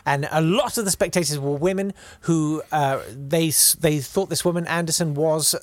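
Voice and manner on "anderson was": ordinary talk, nothing marked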